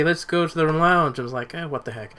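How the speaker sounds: normal talking voice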